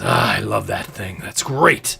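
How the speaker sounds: lightly voiced boastful prince; a touch of self-satisfied gusto; puffed-up prince. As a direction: say it with your chest puffed up. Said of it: "kind of puffs up his chest".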